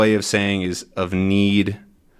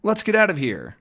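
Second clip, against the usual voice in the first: high, hurried escape call; panicky escape; 9 semitones higher than usual. Acted. Two men fleeing, high panicky call. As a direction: urge your partner to flee.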